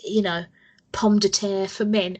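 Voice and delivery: ordinary talk, nothing marked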